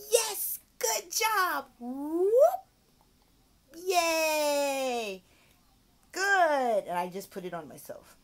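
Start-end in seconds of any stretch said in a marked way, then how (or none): 0.1-6.8 high, loud cheering; hammed-up praise; celebration. Shown as a demonstration. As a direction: celebrate like crazy. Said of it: "celebration demo".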